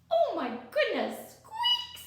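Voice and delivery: a startled little mouse crying out; squeaky mouse. Acted. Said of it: "says the mouse".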